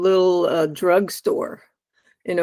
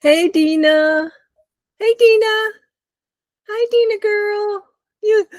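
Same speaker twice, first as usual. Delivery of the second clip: talking to a dog; 12 semitones higher than usual. Real, not acted. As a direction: coo at the pup in a high voice. Talking baby talk to dog.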